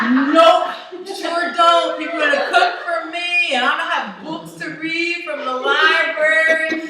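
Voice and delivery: high, loud, giddy declaration; gleeful shout. Real, not acted. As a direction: shout it like freedom is near. Gleeful jail fantasy, shouting.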